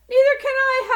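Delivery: high, loud wailing howl. Acted. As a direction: howl in blind panic. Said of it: "howled Flitter".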